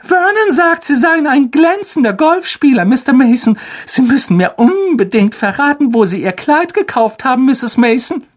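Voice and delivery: very high, piping hostess voice; gushing flatterer aunt. A narrator's reading. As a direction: pour out rehearsed compliments in a high put-on voice.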